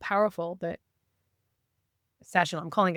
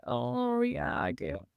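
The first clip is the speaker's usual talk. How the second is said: higher, softer, sheepish admission; small, drawn-back mock confession; retold embarrassment; 4 semitones higher, 4 dB quieter, much slower than usual. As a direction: imitate admitting it reluctantly. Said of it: "I was so embarrassed about it".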